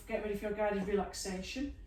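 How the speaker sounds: ordinary delivery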